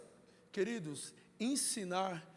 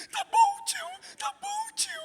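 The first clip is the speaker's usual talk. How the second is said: put-on very high, wailing child's sob; crying child; 6 dB louder than usual. Pastor imitating the crying boy answering his uncle, very high and sobbing. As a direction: whimper it like a hurt little kid.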